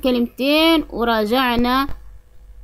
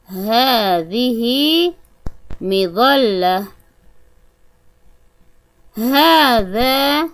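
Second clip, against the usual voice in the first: put-on drawn-out words for children; slow model reading. Shown as a demonstration. Teacher reading word cards aloud to children, slow and clear. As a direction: read each word slowly and clearly. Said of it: "أنطق".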